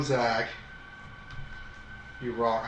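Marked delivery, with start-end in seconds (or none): 2.2-2.7 deadpan fake verdict; mock-serious. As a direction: flatly declare it as a joke. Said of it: "I'm kidding".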